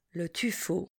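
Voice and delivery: tuffeau repeated very softly. Shown as a demonstration. Teacher echoes tuffeau very quietly for the class. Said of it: "passons au son O".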